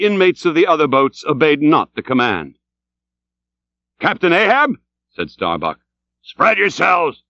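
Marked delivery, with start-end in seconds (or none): 4.0-4.8 high, raised hail to the captain; calling out. A narrator's reading. Starbuck hails his captain Ahab, calling out. 6.2-7.2 high, slow, stretched call; cried command. A narrator's reading. Captain orders the boats to spread, crying out. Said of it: "cried Ahab".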